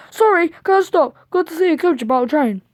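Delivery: fast, rushed excuse; hurried. Acted. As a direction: gabble it out and bolt. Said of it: "he went on hurriedly".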